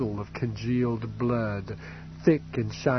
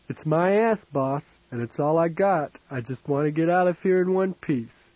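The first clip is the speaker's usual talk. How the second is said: tough street girl, higher, with a hint of pleading; a thief girl bargaining with a drunk old man, higher and pleading; 5 semitones higher, 5 dB louder than usual. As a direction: push back on the boss, talk your way out.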